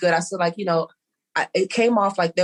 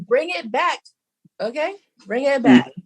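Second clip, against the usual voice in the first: high, pitched-up "bring it back"; mock scolding; 7 semitones higher than usual. Telling an overly nice friend to calm down, high mock scold. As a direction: rein him in, voice jumped up.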